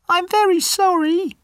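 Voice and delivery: very high, squeaky little mouse; a frightened mouse begging for its life; tiny mouse voice. Acted. Mouse begging the angry lion not to eat it, very high and squeaky.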